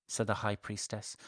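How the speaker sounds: plain reading voice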